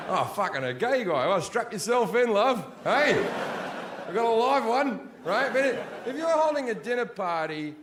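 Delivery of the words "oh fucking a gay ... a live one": excited host. Retold or imitated. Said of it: "You're like".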